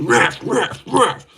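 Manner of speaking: high, repeated punch noises; imitated clinch sounds. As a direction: mimic a flurry of blows.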